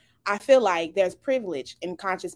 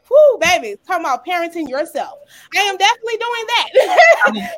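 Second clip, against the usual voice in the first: laughing exclaim; 8 semitones higher, 8 dB louder than usual. Real, not acted. Laughing about her stubborn daughter.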